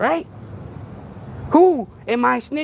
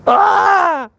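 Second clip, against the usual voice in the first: mock panic; 6 semitones higher, much slower than usual. Mock panic over the kingpin.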